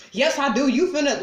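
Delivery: hammed-up stubborn comeback; mock ex's retort. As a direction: imitate the ex cutting in. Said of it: "children's father".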